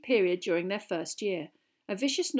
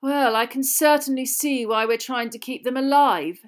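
louder, higher mock agreement; sarcastic; 4 semitones higher, 8 dB louder than usual. A narrator's reading. Malfoy mocking Hagrid's creatures, sarcastically. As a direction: pretend to agree, heavy with scorn. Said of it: "said Malfoy sarcastically".

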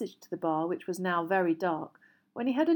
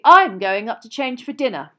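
loud, higher lofty announcement; self-important exit line; 4 semitones higher, 14 dB louder than usual. A narrator's reading. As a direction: announce it with haughty dignity. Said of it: "what loftily".

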